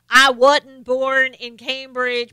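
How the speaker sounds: loud, slow Southern drawl; exaggerated Southern accent, drawn out; mock Southern accent. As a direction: imitate a politician's fake Southern twang. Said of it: "get on a Southern accent".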